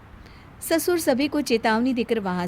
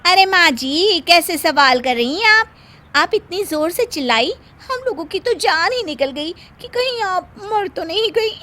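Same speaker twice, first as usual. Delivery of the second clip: mock alarm; 7 semitones higher, 6 dB louder than usual. Bahu mocking alarmed concern.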